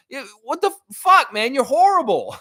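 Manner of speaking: fed-up ranting at the broadcaster; angry rant. Real, not acted. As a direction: rip into the announcer. Ranting at announcer.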